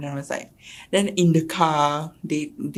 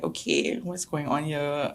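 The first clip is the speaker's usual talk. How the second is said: hushed puzzled aside; 6 dB quieter than usual. Retold or imitated. Her inner reaction, hushed.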